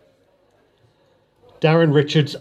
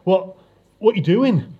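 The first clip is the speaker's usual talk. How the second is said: higher, small, frightened voice; small insecure voice; 6 semitones higher than usual. Acted. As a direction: ask it scared and unsure. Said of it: "It was a small, insecure thing".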